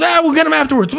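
high casual brush-off; mock usher voice; breezy usher. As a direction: wave it off lightly. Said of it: "He goes".

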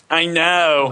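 pinched nasal hygienist voice. Retold or imitated. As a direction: say it through the nose. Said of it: "this nasal voice".

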